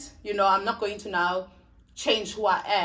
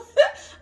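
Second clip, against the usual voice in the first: sudden high burst of laughter; loud real laugh; 9 dB louder than usual. Real, not acted. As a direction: let a squeaky laugh escape. Laughs after denying a comment.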